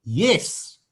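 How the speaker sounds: upbeat, affirming Yes; positive yes. Shown as a demonstration. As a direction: say Yes like good news. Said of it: "Then you say".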